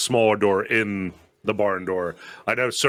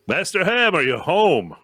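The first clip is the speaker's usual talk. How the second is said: high, raised call through the door; 8 semitones higher than usual. Acted. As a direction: call out to whoever is inside. Calling at the smithy door.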